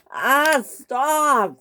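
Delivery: high, panicked cry to stop; frightened cry. Acted. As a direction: yelp it out in alarm. James cries out being pulled.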